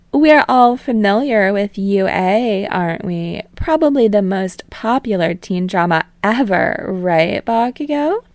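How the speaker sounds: put-on playful TV host calming the boys, then prying; teasing talk show host. Host Utsushimi needling Bakugo.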